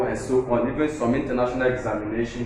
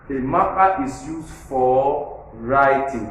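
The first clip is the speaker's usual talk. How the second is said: slow, with "writing" stressed; teacher slowly stresses "writing"; new information stress; slower than usual. Shown as a demonstration. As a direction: stretch it out, hit "writing". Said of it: "That writing now, you stress it".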